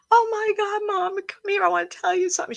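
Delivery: very high imitation of a yelling teenager; excited yelling. Psychic mimics teenage girl calling her mom, excited mock yelling. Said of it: "She was yelling".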